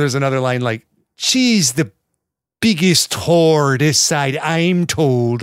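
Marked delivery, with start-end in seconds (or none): 1.2-5.4 hammed-up fake Irish accent, slow; imitating a bad Irish movie accent. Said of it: "an Irish accent despite not being Irish".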